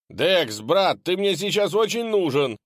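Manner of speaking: high, loud call for help; desperate shout. Acted. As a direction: yell for your friend. Yelling for help.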